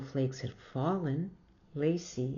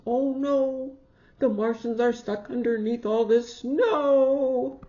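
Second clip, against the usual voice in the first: higher, louder mock alarm; 7 semitones higher, 8 dB louder than usual. Child worried about the buried Martians, alarmed. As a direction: cry out in playful alarm. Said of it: "child alarmed in story".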